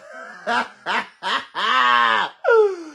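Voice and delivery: big falling cackle. Real, not acted. Laughing at the insult.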